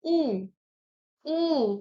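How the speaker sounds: उ held long through rounded lips; isolated, drawn-out उ for learners; labial vowel demonstrated. Shown as a demonstration. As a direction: say उ, then उ, well apart. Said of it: "अब हम बोलेंगे अगर".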